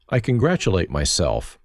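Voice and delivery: usual speaking voice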